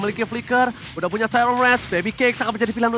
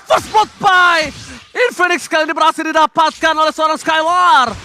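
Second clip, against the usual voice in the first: excited play-by-play shout; 7 semitones higher, 5 dB louder than usual. Acted. Hyped play-by-play on first blood.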